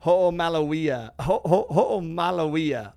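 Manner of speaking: "ho'omalauia" drawn out slowly, with a stumbling restart; careful pronunciation. Shown as a demonstration. Guest showing the host the Hawaiian word, "ho'omalauia" said slowly. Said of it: "so I don't butcher this pronunciation".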